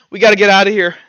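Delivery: loud, urgent call over the fire; shouting orders through smoke. Acted. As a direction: bark it so the others move now. Vex orders escape from fire.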